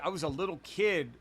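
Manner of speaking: normal talking voice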